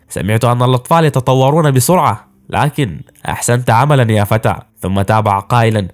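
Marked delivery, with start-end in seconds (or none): none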